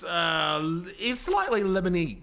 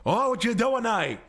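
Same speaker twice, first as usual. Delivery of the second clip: lightly put-on Lebanese Australian accent, aggressive; 2 semitones higher than usual. Showing a mate a Lebanese Australian accent, aggressive. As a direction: throw a mild Western Sydney Lebanese Australian accent at him. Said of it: "a Lebanese Australian accent".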